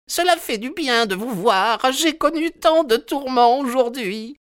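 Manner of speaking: very high, overwrought woman's voice; distraught housekeeper in falsetto; hysterical old woman. A narrator's reading. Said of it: "d'une voix hystérique".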